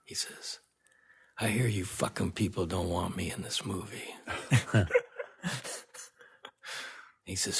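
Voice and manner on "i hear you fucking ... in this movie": imitating a hushed, intimidating actor; quiet menacing voice. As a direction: lean in and threaten softly so they strain to hear. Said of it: "voice, they had to lean forward to hear him".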